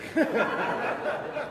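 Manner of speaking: short high-pitched laugh; a quick amused laugh. Real, not acted. Laughs at his own story.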